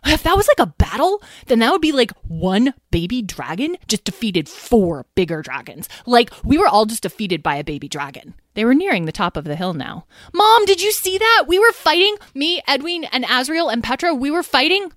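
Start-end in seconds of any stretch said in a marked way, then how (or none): 0.0-8.4 excited gushing. A narrator's reading. Lyle raving about the crash. 10.3-14.9 put-on eager excited kid. A narrator's reading. Lyle eagerly telling Mom.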